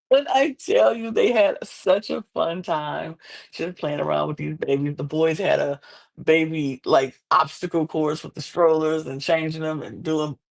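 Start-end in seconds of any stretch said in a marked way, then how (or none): none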